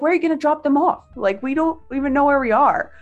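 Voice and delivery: high, rushed questioning; alarmed protest. Retold or imitated. Retells alarmed protest to driver.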